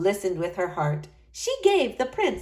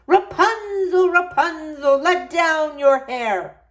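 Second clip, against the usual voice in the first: high, loud, slow call up the tower; witch calling; 8 semitones higher, 5 dB louder, slower than usual. A narrator's reading. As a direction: call her name up to the window. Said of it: "the witch called".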